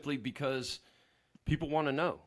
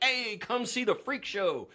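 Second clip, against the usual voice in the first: high, slow, hammed-up barker call; carnival sideshow barker; 8 semitones higher, 3 dB louder than usual. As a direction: pitch it to the crowd like a sideshow barker. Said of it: "carnival sideshow barker".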